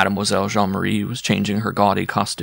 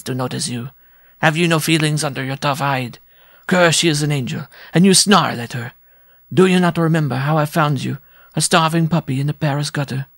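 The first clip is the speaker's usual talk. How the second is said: a little dwarf berating his dog, higher; 5 semitones higher than usual. Acted. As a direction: chide the ungrateful dog in a raised voice.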